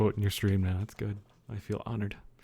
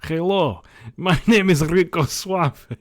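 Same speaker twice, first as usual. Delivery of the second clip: loud, high hammed-up Russian accent, laughing through it; mock Russian accent; 7 semitones higher, 9 dB louder, slower than usual. Streamer jokingly introduces herself as Rico Suave, in a hammed-up Russian accent. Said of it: "I just did a Russian accent".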